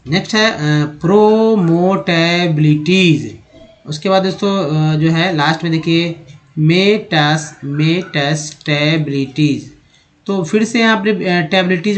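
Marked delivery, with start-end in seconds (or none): 1.0-3.4 slow, syllable by syllable; sounding out. Shown as a demonstration. Teacher sounding out a long word. 6.6-9.7 very slow, syllable by syllable; sounding out. Shown as a demonstration. Teacher sounding out a long word.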